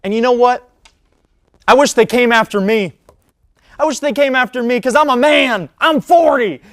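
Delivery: loud, high-pitched shouted imitation; impassioned coach rant. Host mimics Mike Gundy's famous rant, impassioned shouting. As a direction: bellow it like a fired-up coach. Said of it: "he had such passion".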